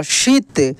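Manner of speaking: शीत with the ī held long; drawn-out long ī. Shown as a demonstration. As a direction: stretch the ī in शीत. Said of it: "इसका उच्चारण खींच कर होता है". (शीत की ई खींच कर)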